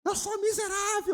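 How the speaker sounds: hammed-up stubborn sister repeating herself; whiny sister insisting. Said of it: "Ficou com raiva". (irmã teimosa imitada com voz bem aguda e chorosa)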